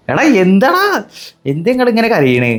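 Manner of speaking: high-pitched, exasperated taunt; taunting outburst. Real, not acted. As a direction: pitch leaps up, teasing the sore losers. Taunts viewers who are crying.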